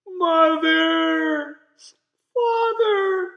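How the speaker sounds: high, loud tearful wail of names; crying out. A narrator's reading. Lost girl calling for her family, crying. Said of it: "she cried".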